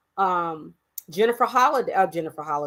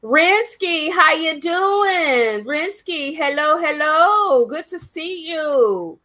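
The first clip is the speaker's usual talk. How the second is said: excited greeting; 5 semitones higher, 3 dB louder, slower than usual. Real, not acted. Greeting a viewer by name.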